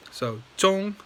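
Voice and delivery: Zhong held high and flat; high level tone on Zhong; first tone Zhong. Shown as a demonstration. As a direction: sing Zhong on one high level pitch. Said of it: "high flat".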